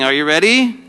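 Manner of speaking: usual speaking voice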